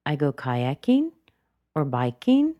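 "kayaking" and "biking" lifted at the end; light, unfinished upturn on "biking"; rising intonation. Shown as a demonstration. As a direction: leave "biking" hanging upward. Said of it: "She goes up in intonation".

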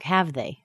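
"have they" dropping down at the end; "have they" falling, seeking agreement; falling intonation. Shown as a demonstration. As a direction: stress "have" and fall on "they". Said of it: "It drops down".

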